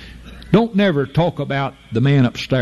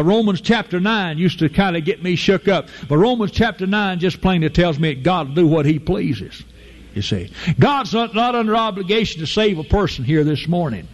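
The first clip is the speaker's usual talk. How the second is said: ordinary talk, nothing marked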